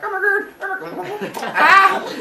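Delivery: very high, laughing mock panic; frantic panic. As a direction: squeal like a kid losing an arm.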